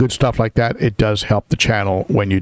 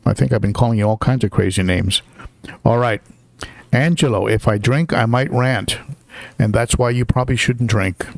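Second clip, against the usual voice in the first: usual speaking voice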